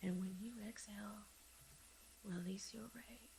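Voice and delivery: whispered guidance. Acted. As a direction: whisper it close.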